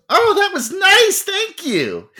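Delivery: high, sing-song mock thanks; sweetly mocking enemy. Enemy captain mocking a weak fire spell, high and sarcastic. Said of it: "And she goes".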